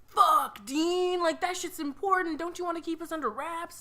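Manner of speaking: high, upset alien buddy scolding. Retold or imitated. Alien buddies scolding Dean for losing files, high voice. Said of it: "they were like".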